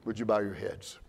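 hushed pastor's invitation. Acted. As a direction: draw the congregation in gently. Quiet altar-call invitation.

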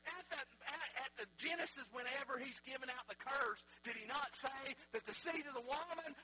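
preaching at full force. Acted. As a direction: preach it out with fire.

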